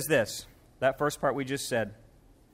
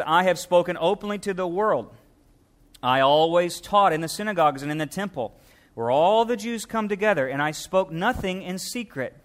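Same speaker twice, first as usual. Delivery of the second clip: louder, raised reading of the verses; scripture reading; 3 semitones higher, 4 dB louder than usual. Acted. Preacher proclaiming Jesus's words to the congregation, louder.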